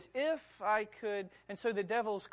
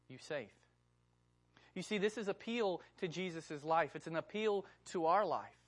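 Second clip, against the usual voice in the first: normal talking voice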